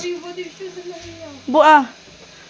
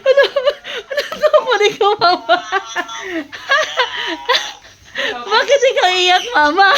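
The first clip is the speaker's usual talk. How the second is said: high-pitched giggly teasing question; amused, giggling tease; laughing question; 7 semitones higher than usual. Real, not acted. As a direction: laugh through the words, teasing mom about crying. Laughing at crying mother.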